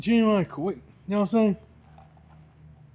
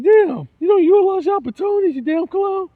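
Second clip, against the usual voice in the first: much higher, loud scornful yell; retold angry yell; 8 dB louder than usual. Scolding a big-city guy for not using opportunities, scornful yell. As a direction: blast him for wasting his chances.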